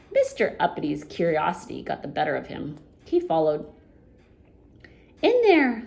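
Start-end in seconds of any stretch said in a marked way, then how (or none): 5.2-5.8 high, loud goblin. Acted. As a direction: point and chirp it. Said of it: "said the goblin".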